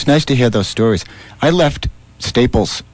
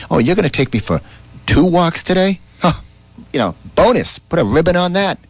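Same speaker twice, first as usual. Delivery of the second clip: happy dog voice; 7 semitones higher than usual. Pretend.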